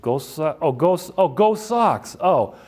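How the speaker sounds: the penny drops, voice climbing; surprised realization, going up; dawning realization. Retold or imitated. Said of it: "realizing what he meant".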